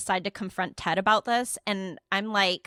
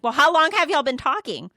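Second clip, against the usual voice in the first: higher, louder demanding question; acted-out incredulous questioning; exasperated interrogation; 6 semitones higher, 6 dB louder than usual. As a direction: retell grilling him, voice raised. Retold interrogation of cheating friend.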